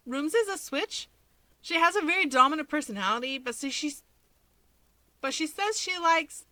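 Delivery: can't believe what he heard; incredulous. A narrator's reading.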